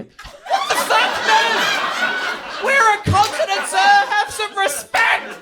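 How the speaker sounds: high, drawn-out indignant yell; mock outrage. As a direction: shriek it slowly in hammed-up offence. Mock outrage at being dismissed.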